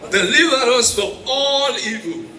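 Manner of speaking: ordinary talk, nothing marked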